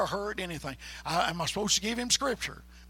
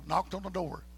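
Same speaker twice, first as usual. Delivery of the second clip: usual speaking voice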